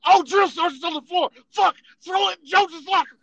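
high, loud frantic warning; panicked hurry. Retold or imitated. Roommates panicking as the drill sergeant arrives, high and loud. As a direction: scramble to hide it before the sergeant comes.

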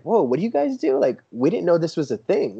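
high amazed whoa; amazed visitors. Retold or imitated. Visitor at the toy photography booth, amazed and high. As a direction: act out the wide-eyed wonder of passers-by.

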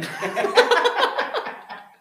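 loud squealing laugh; high shrieking laugh. Real, not acted. Laughs at own joke.

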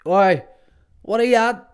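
high, loud call across the street. Retold or imitated. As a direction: shout a greeting to a mate. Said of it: "this guy went".

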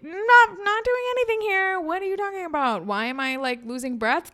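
high, loud flustered denial, caught in the act; defensive denial. Retold or imitated. To a sibling walking in, high panicky denial. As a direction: bluster like someone caught red-handed. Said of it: "'Cause I have to just be like".

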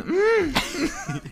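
squeaky cracked hum. Real, not acted. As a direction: voice cracks up high. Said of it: "I'm not going through puberty".

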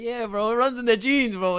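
cocky. Retold or imitated. Telling his friend how he answered the doubting inmate, high and cocky. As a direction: swagger the line out.

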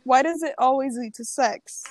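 normal talking voice

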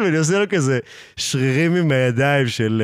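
ordinary talk

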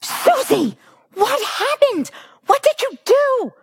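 very high-pitched mock shriek; imitated scream. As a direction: act out a horrified mother screaming. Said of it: "and screams".

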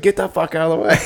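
high-pitched comic impression breaking into laughter; mock angry yell. Joking about the spliced-in trailer outburst, mock angry. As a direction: squeal the angry line as a joke. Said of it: "He's like".

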